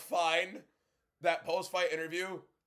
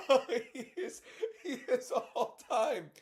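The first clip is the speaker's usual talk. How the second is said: laughing; 8 semitones higher, slower than usual. Real, not acted. Laughing at Bobby.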